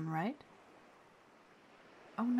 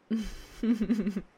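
loud chuckling burst; teasing laugh; 7 dB louder than usual. Acted. Teasing someone about a skin-tight outfit, laughing loudly. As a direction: laugh out loud at your own tease.

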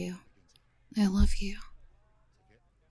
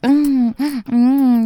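loud moan; 6 semitones higher, 13 dB louder than usual. Acted. Moaning during the act.

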